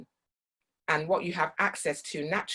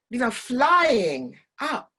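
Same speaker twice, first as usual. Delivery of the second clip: "flying" drawn out slow and high; stretched vowels; 5 semitones higher, 5 dB louder, slower than usual. Shown as a demonstration. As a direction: draw out "flying up" with excitement. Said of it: "play with the vowels".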